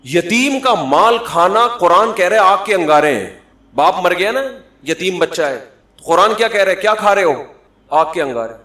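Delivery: loud, high, insistent sermon; impassioned preacher's rebuke; fiery preaching. Acted. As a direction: press the congregation hard. Preaching at full force.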